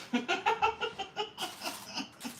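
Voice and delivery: high-pitched laugh at herself; high giggle. Real, not acted. Laughs at own impression.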